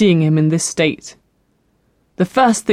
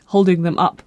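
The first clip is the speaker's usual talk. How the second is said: ordinary delivery, nothing marked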